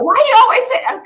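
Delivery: high, fast, loud husband impression; wife mimicking her husband's question, high and fast; imitating husband. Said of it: "He was like".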